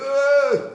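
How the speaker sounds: high, loud frightened shriek; imitated scream of a startled wife; mock scream. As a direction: scream like she just saw a mouse. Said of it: "Elle va crier".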